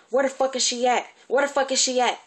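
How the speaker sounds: loud, high furious yell, imitating the auntie; furious shout. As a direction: bark it out like an enraged aunt storming in. Auntie storming in, cursing.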